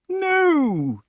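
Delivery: very high, loud wail of correction; King Pellinore. A narrator's reading. Pellinore contradicts Grummore, high and exasperated. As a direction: stretch the word out high.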